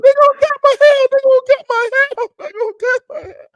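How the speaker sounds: very high, hammed-up wail; imitating his own tearful meltdown; mock crying. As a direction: squeal it like a comic sobbing breakdown. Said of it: "the most I ever cried in my damn life".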